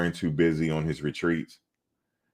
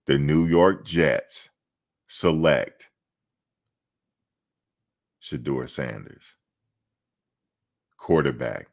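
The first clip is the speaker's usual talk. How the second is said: draft announcer; 2 semitones lower than usual. Pretend. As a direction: announce the pick like the commissioner on stage. Mock draft pick announcement.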